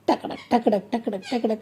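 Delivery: tongue-tapped hoofbeats in even, light bursts; galloping horse imitation. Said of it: "कशा घोडा, घोडा कशा पळतो".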